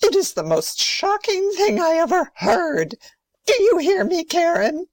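high-pitched, scandalised outrage; shocked scolding. Acted. Grandmother rebukes Karen over the red shoes, outraged.

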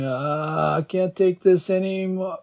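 high, strained cry of agony; pained outcry. A narrator's reading. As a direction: sustain a high, pained wail through the words. Acted unbearable head pain.

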